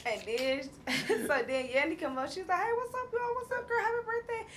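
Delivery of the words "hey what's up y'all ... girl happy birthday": bubbly greeting. Retold or imitated. Yandy greeting at party.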